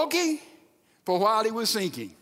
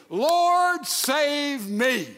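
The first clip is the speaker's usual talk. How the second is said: crying out; 6 semitones higher, 4 dB louder, much slower than usual. Retold or imitated. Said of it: "he cried out".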